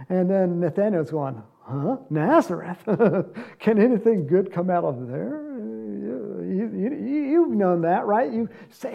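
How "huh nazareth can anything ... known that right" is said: skeptical Nathaniel. Retold or imitated. Nathaniel voiced doubting Nazareth.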